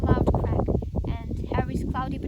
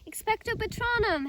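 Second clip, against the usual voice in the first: spell shout; 9 semitones higher than usual. Acted. Casting the spell.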